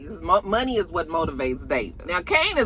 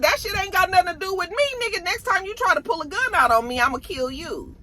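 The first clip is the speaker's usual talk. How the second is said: threatening Kane; 11 semitones higher than usual. Retold or imitated. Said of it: "Kane said".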